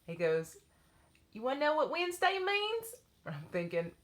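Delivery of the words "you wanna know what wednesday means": put-on high, sweet Southern child voice; little boy voice. Little boy asking his dance teacher, high child voice. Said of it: "I have to do his voice".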